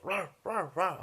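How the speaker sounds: spoken "rawr" dog barks; imitated dog bark. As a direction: bark like a dog, in short bursts. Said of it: "bark like a, a dog".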